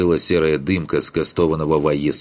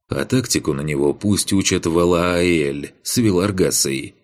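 usual speaking voice

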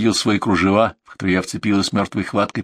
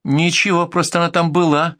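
put-on higher, childlike voice; маленькая девочка; 5 semitones higher than usual. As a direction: answer guilelessly as a small child. Little girl answers the question. (по-детски, высоким голоском)

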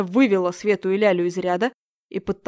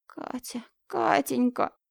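put-on grieving old woman; 4 semitones higher, slower than usual. Grieving neighbour crying.

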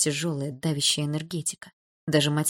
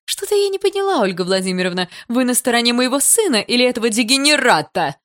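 high, loud indignant challenge; angry mother demanding whose side you're on; angrier still; 7 semitones higher, 7 dB louder than usual. A narrator's reading. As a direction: confront the teacher in loud outrage. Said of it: "Есина рассердилась ещё сильнее".

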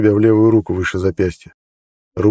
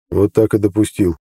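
tired answer. A narrator's reading. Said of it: "устало ответил Нед".

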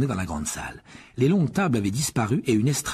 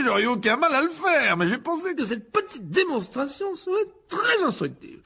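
high, loud, blustering excuses; flustered pompous teacher; 10 semitones higher, 5 dB louder than usual. A narrator's reading. Lockhart excuses his defeat to Rogue and pupils, flustered but pompous. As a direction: save face with showy bluster and stumbles.

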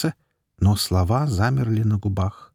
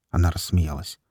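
ordinary delivery, nothing marked

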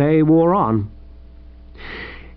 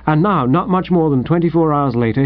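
ordinary delivery

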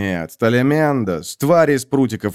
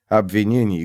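plain reading voice

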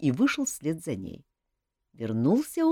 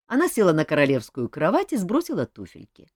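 plain reading voice

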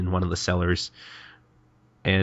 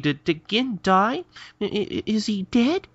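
high, slow stammered worry; mock alarm; 12 semitones higher, slower than usual. Kagura asks whether Gin is dead, mock alarm. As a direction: stammer it out in high-pitched disbelief.

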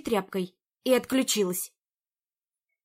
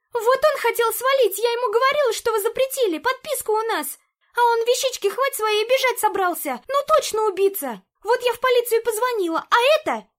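rattled off in one breath, high and loud; jittery nonstop chatter, pitched way up; too much caffeine; 12 semitones higher, 7 dB louder than usual. A narrator's reading. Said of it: "она затараторила на одном дыхании".

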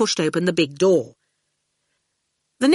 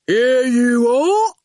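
slow, loud, pleased henchman voice; proud goon; 6 semitones higher, 5 dB louder, much slower than usual. A narrator's reading. The goon proudly presenting Mountjoy to Howard, slow and loud. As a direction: present him like a prize.

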